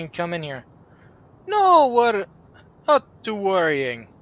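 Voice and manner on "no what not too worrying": higher, louder mock Russian protest; indignant Russian-accented outburst. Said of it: "he's Russian".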